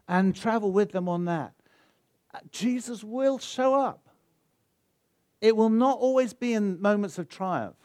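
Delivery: high, loud, building sermon peak; soaring, ringing sermon climax; impassioned preaching. Acted. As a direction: preach it with rising fervour. Preaching climax, rising intensity.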